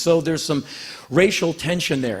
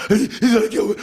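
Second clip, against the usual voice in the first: put-on high, louder frightened child voice; scared little kid; 8 semitones higher, 5 dB louder than usual. Frightened boy telling his mom about the bully. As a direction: panic like a small boy.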